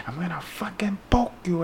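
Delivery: mock threat from the needle guy, whispered; menacing whisper. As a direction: hiss it like a creepy threat. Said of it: "I say".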